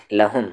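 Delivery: لہم said quickly with the lam running straight into the ha; lam joined to ha. Shown as a demonstration. Teacher modelling the joined reading of لہم for pupils. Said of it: "لام کو ہا کے ساتھ".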